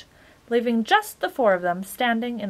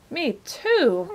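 higher, louder plaintive moan; complaining; 6 semitones higher, 5 dB louder than usual. A narrator's reading. Hungry Milo agreeing with the bug, complaining. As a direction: complain along with him. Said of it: "complained Milo".

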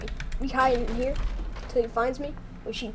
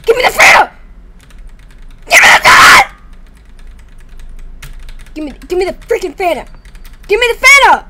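loud insistent demands; demanding shout; 3 semitones higher, 3 dB louder, much faster than usual. Real, not acted. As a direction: demand it repeatedly.

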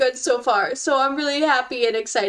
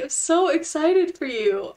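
normal talking voice

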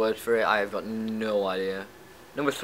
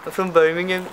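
put-on higher-pitched Birmingham accent send-up; mock Birmingham accent; 5 semitones higher than usual. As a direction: imitate someone from Birmingham. Mocking people from another English city.

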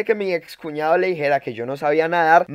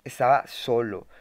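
forlorn; 4 semitones lower, slower than usual. Acted. Dwells on being alone in Medellín.

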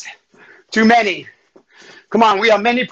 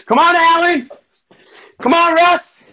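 high-pitched urging call; calling out encouragement; 7 semitones higher than usual. Real, not acted. Instructor cheering on Alan and Russ, calling out. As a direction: call to them by name, pitched up.

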